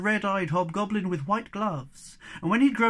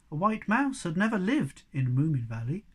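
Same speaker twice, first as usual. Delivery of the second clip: ordinary delivery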